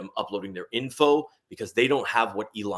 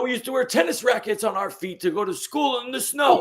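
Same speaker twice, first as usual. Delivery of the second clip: mock old-timer; 14 semitones higher than usual. Mocking an older generation's story.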